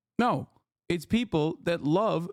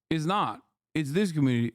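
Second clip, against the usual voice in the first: ordinary talk, nothing marked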